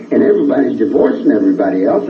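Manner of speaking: normal talking voice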